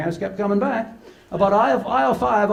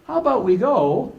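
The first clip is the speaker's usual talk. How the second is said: put-on little girl bargaining; 7 semitones higher than usual. Granddaughter voiced, bargaining.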